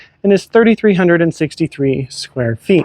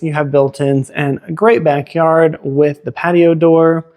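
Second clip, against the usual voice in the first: ordinary talk, nothing marked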